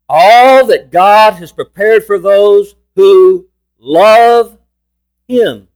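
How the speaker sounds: very slow, loud, stretched-out verse; proclaimed scripture reading. Real, not acted. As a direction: hold each key word, then settle on the last. Reads scripture with force.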